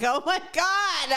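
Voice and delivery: high, drawn-out mock dismay; retold exasperation. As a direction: stretch it out in disbelief. Retelling her exasperated reaction.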